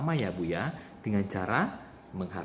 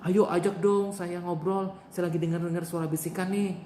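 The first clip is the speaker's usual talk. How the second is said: pleading patient; 6 semitones higher than usual. Shown as a demonstration. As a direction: beg a roommate to talk with you. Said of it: "saya praktekkan ya".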